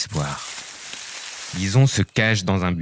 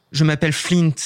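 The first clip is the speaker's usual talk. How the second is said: elf voice; 7 semitones higher than usual. Acted. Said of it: "répond la créature".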